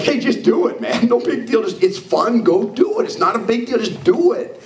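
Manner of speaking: high-pitched, carefree pep talk; acted impatient cheerleading; breezy go-getter. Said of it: "that's kind of my personality".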